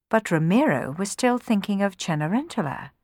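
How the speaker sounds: ordinary delivery, nothing marked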